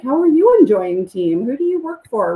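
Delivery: deflecting to the fan with eager questions; mock eager questions. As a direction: fire back questions brightly. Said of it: "throw it back on them".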